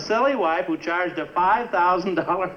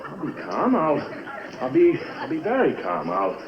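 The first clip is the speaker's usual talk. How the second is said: ordinary talk, nothing marked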